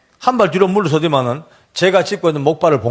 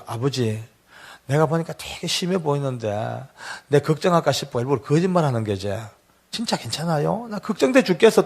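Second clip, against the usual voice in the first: much softer, hushed into the ear; ear whisper; 2 semitones lower, 8 dB quieter than usual. Retold or imitated. Son whispering into his father's ear, hushed and worried. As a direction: murmur close to the father's ear. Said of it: "제 귀에 대고 이렇게 얘기해".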